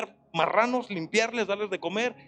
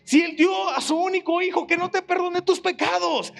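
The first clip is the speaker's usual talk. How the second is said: raised, ringing preacher's voice; preaching at full force; 4 semitones higher than usual. Real, not acted. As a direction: build the fervour and drive it home. Preacher building to climax. (predicando con fuerza y voz elevada)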